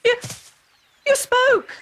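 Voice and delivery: put-on boy stunned that his cat speaks; startled. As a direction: gasp it out, amazed the cat can talk.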